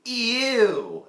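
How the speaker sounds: high, drawn-out mock squeal of disgust. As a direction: imitate a grossed-out girl. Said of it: "that said "ew".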